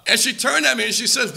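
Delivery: usual speaking voice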